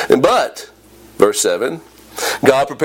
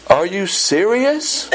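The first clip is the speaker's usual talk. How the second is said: slow, drawn-out disbelieving question; incredulous; 3 semitones higher, much slower than usual. Acted. God responding to Jonah's complaint, incredulous. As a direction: ask it in slow disbelief, rising at the end. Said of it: "can't decipher the tone of voice of the Lord".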